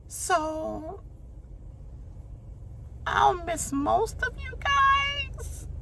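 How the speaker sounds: high squeaky put-on voice, fake tears building; mocking Keisha's teary speech. Mocking Keisha choking up during her eviction speech, high teary voice. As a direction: parody a tearful farewell, high and breathy. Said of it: "all Keisha could come up and do was".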